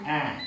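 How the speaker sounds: A said with an open mouth; open a sound. Shown as a demonstration. Teacher demonstrating the open vowel sound. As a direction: open the jaw for A. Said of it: "con la boca hacia abierta".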